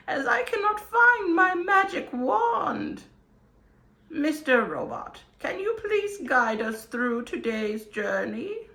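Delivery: put-on high theatrical old wizard; grand storybook wizard greeting. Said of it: "your wizardy companion".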